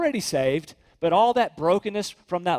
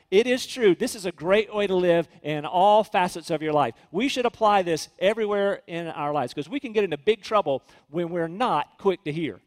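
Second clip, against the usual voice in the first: ordinary delivery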